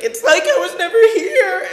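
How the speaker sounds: high, loud crying wail; anguished cry. Retold or imitated. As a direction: wail it out, half laughing. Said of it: "and I was just like".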